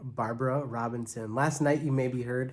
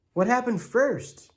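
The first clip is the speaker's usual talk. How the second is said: put-on high, loud holler of a rough kid; 9 semitones higher, 4 dB louder than usual. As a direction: yell it across the room at the teacher. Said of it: "Imogen hollered at my mother".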